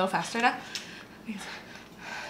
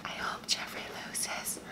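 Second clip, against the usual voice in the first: whispered aside; 11 dB quieter, much slower than usual. Real, not acted. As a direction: whisper it so they can't hear. Aside during challenge.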